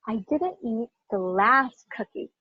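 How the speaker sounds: "last" stretched out and prominent; stress on LAST. Shown as a demonstration. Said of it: "So let me put emphasis on".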